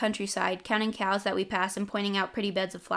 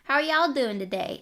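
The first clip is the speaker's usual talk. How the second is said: higher-pitched southern waitress greeting; deep southern accent; 5 semitones higher, 4 dB louder than usual. A narrator's reading. Waitress greets two diners, southern accent. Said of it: "she had a deep southern accent".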